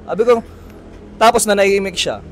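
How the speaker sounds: normal talking voice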